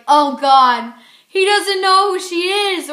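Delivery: loud, high panicked inner voice; reader's panic. Retold or imitated. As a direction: gasp it like a worried reader. Reader panicking while reading.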